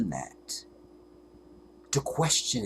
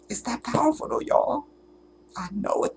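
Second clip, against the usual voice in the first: high, crying voice; tearful; 11 semitones higher than usual. Real, not acted. Overwhelmed by her revelation, weeping. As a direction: talk through real tears. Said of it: "I can't stop 'em now".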